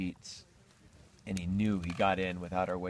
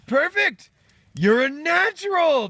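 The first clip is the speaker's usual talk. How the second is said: very high, loud cheering; excited praise; 12 dB louder than usual. Acted. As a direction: whoop like a thrilled birth coach. Rooster cheers the first egg.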